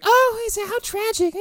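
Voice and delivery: very high falsetto fake pity; hammed-up squeaky commiseration; mock sympathy. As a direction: imitate women cooing with put-on concern. Voicing the women's fake sympathy.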